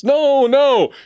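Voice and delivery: very high, loud interruption; shrieked-up protest; frantic denial. A narrator's reading. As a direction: contradict him in a panic. James contradicts the colonel's yes.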